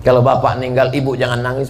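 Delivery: ordinary delivery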